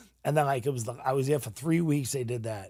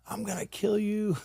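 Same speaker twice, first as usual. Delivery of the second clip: retold yell; 7 semitones higher than usual. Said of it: "I'm, like, yelling and screaming".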